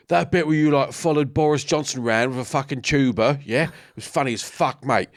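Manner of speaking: Cockney bodyguard. Retold or imitated. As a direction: imitate a Cockney fan pestering a mate. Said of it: "big Cockney bodyguard".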